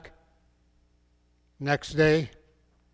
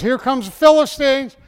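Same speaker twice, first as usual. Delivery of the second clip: higher, louder warning cry; alarmed call; 6 semitones higher, 7 dB louder than usual. Acted. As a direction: call out that the enemy is coming. Delilah alarms Samson.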